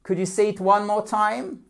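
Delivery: high, cheery question with a rising end; good-humoured, high teacher voice; joyful. Shown as a demonstration. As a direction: lead a playful repeat drill. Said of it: "in a joyful atmosphere".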